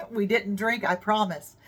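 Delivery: ordinary talk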